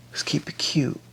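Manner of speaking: soft unvoiced whisper. Real, not acted. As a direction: breathe it as a secret aside. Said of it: "whispered aside".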